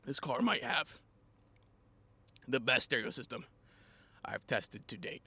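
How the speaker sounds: winded and breathy; out of breath. Real, not acted. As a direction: gasp between words. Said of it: "I'm out of breath".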